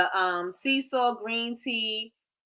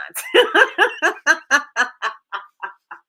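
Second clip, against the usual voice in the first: loud laugh; 5 semitones higher, 7 dB louder than usual. Real, not acted. Laughs about phone lines.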